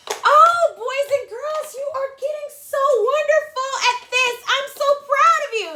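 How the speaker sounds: high, bright, gushing praise; enthusiastic praise. Real, not acted. Teacher to boys and girls, gushing praise. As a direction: lift them up with delight.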